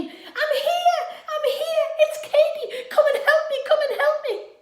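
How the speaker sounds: high, loud desperate shouting; calling out for help. Acted. Stranded girl shouting to a passing ship, high and loud. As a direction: yell to the distant boat with all you have. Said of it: "she started to shout and scream".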